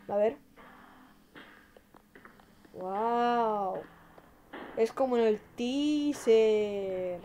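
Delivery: ordinary delivery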